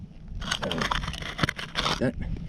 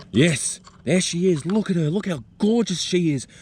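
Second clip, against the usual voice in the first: much higher and louder, slow with delight; thrilled; 9 semitones higher, 4 dB louder than usual. Real, not acted. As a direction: burst out in wonder as she finally appears. Spider finally emerges, delighted.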